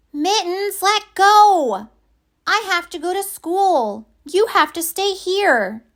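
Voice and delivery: put-on high little girl begging the pet; child voice pleading. Penelope pleading with Mittens at the door, high child voice. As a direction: tug and plead like a small kid.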